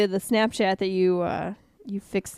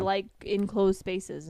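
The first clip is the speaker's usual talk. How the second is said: ordinary talk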